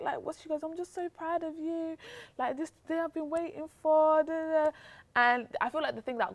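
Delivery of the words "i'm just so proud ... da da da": high-pitched, mildly weepy mum voice; mock-tearful, voice lifted high; imitated tearful mum. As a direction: act out mum overcome with pride. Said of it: "my mum was crying".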